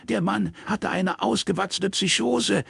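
high, clipped retort from the angry daughter; lightly voiced daughter arguing back; defiant daughter. Daughter arguing with her father, lightly voiced anger.

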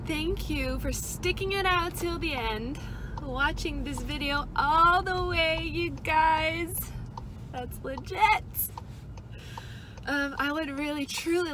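loud, high, upbeat thanks; excited. Real, not acted. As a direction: pump up the viewers. Energetic vlog outro.

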